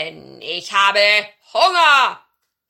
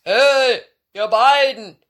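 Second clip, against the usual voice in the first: very loud bellowed call; booming giant shout; giant's roar; 4 semitones higher, 14 dB louder, slower than usual. A narrator's reading. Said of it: "brüllte er sie an".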